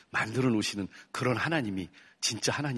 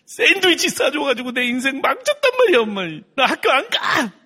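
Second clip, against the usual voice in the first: put-on high, loud, tearful child's yell; child yelling at mom; 14 semitones higher, 10 dB louder than usual. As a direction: scream it at mom through tears. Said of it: "엄마한테 소리 질렀어요". (엄마에게 울며 소리치는 아이 목소리)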